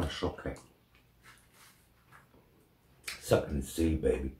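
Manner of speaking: sucking a sweet while talking; mouth full of chocolate. Real, not acted. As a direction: lips pursed around food. Seen 1: Right man puts chocolate in mouth, lips pursed while talking.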